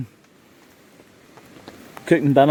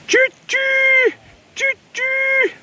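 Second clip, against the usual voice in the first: very high choo-choo hoot; train whistle imitation; much slower than usual. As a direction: hoot like a little steam train. Imitates a train at stovepipe smoke.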